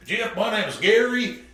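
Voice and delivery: a hint of a friendly Texas businessman introducing himself; imitating a Texan.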